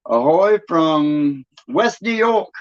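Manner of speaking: slow, drawn-out put-on New York accent; mock New York accent, vowels held long. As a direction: hail them in a stretched New York accent. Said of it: "they don't have that accent up there".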